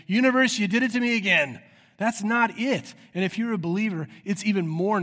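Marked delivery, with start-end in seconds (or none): 0.1-1.6 loud, high-pitched mock lament; mock cry to the universe. Preacher imitating someone blaming the universe under the stars, loud mock wail. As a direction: call up to the stars in exaggerated complaint. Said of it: "looking in the stars and being like".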